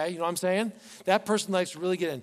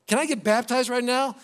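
higher, louder, eager ask; eager request; 7 semitones higher, 8 dB louder than usual. Retold or imitated. Eunuch eagerly asking Philip, excited and loud.

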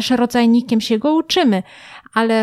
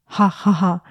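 a lower, throaty "Ha, ha, ha"; low laugh; 4 semitones lower than usual. Shown as a demonstration. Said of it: "Zaśmieje się takim niskim głosem". (niski, gardłowy śmiech "Ha, ha, ha")

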